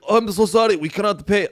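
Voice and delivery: put-on high, muddled foreign accent for Greece; botched Greek accent. Greece pleading it cannot pay, botched high Greek accent. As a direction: play Greece apologizing in a bad high accent. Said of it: "I can't do any accents".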